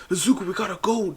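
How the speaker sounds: urgent call. Retold or imitated. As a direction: hurry him, calling out. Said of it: "they're like".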